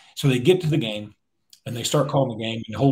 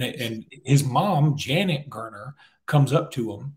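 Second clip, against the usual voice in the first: ordinary talk, nothing marked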